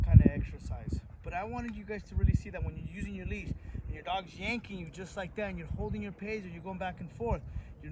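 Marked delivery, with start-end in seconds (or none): none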